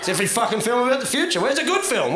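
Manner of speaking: ordinary talk, nothing marked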